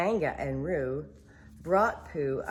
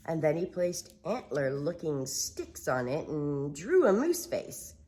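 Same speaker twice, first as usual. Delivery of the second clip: ordinary delivery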